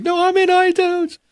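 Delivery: high, loud, squealing disbelief; ecstatic imitated squeal. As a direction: squeal it like you can't believe you did it. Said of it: "she called me the next day crying".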